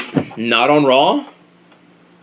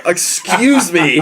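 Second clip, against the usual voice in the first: slow, stuck-up brush-off; haughty excuse me; 4 semitones higher, much slower than usual. Retold or imitated. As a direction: look down your nose, slowly. Mock Charlotte snapping at fan.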